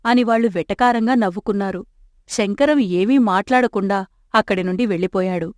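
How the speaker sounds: plain reading voice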